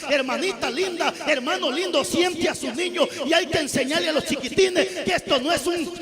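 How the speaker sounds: loud, high scolding sermon at full cry; rally the congregation, shouting the rebuke; full-force preaching. Acted.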